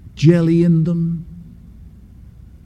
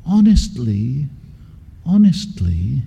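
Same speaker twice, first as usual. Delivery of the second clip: put-on slow, lower, earnest little-boy plea; pleading innocence; 4 semitones lower than usual. Boy insisting to his mother he's innocent, slow and earnest. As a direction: sound as innocent as a small liar can. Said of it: "did you hear what I just did?".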